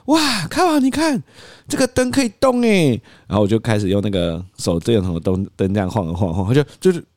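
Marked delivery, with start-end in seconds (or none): 0.1-2.9 very high, sing-song wonder; coaxing toddler. Acted. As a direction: draw the child's eye with delight. 父亲哄孩子看灯. (高音惊喜地哄小孩)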